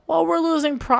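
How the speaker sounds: high-pitched mock excuse; whiny put-on company voice; mocking imitation. Mocks the insurer's excuse.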